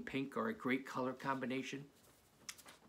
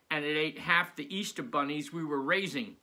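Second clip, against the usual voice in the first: usual speaking voice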